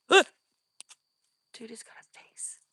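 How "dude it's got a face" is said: tense, voiceless whisper. Real, not acted. Watching the hairy creature, scared whisper. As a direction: keep it to a breath so it stays still.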